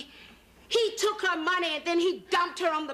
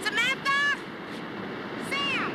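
calling out; 11 semitones higher than usual. Acted. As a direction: shout her name to find her. Searching the beach for her.

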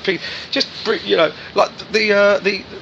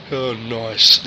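[name]; 6 semitones lower than usual. Retold or imitated. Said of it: "he just looked at me going".

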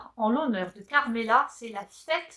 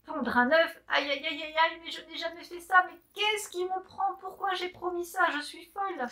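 panicked fretting; 6 semitones higher than usual. Acted. Hen panics at her promise.

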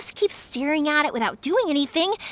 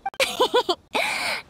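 high airy giggle; a cheerful little laugh; bright giggle; 8 semitones higher than usual. Acted.

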